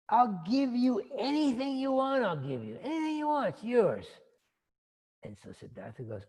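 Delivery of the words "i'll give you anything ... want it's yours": high, loud, effusive offer; pleading royal father. Acted. King offering his sad son anything, pleading generosity. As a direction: promise the world in a raised voice.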